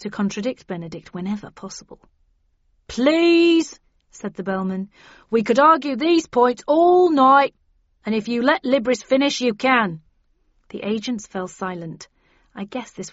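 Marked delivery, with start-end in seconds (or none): 2.9-3.8 loud, high, drawn-out plea; exasperated call for order. A narrator's reading. Bellman breaks up agents' argument. 5.3-10.0 raised, higher voice over the squabble; exasperated call for order. A narrator's reading. Bellman breaks up agents' argument.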